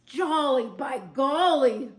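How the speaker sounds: loud, slow, hearty exclaim; jolly exclaim. A narrator's reading. Barnaby kicks and shouts joyfully about the tree. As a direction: kick up your heels and shout it. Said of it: "said with a kick".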